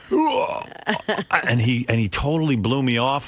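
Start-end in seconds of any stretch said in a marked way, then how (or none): 0.1-0.7 disgusted groan. Retold or imitated. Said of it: "Chris Rock was like".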